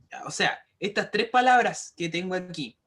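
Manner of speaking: ordinary talk, nothing marked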